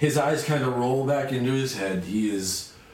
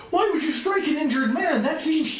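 put-on shocked child; 13 semitones higher than usual. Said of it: "Pillar will say something to the effect of".

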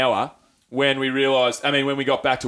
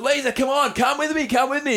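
high-pitched begging, comic desperation; mock urgent pleading; 11 semitones higher than usual. Man begging his mate to follow him, mock pleading. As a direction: beg your mate along in a raised voice.

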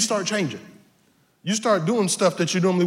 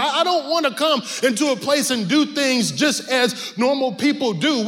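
normal talking voice

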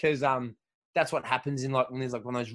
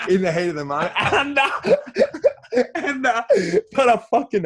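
louder and higher, laughing through the words; 7 semitones higher, 7 dB louder than usual. Real, not acted. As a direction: crack up while telling it. Laughing while recalling the fight.

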